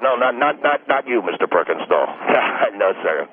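ordinary delivery

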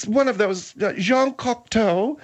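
usual speaking voice

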